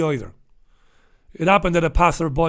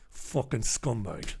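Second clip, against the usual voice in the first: muttered disgust; 9 semitones lower, 15 dB quieter, slower than usual. Real, not acted. Muttered insult at the cop.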